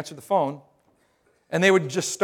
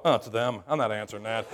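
softer, lower aside to yourself; muttered aside; 8 semitones lower, 7 dB quieter than usual. Retold or imitated. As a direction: mutter it like you're dodging the call. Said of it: "We just pick up our phone and go".

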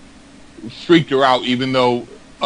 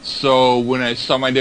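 ordinary talk

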